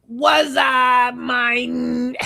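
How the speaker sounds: high, loud squeaky puppet; retelling Key and Peele, Little Homie greets the parolee in a loud puppet voice. Retold or imitated. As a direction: shout it like a little hand puppet. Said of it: "it's like a puppet".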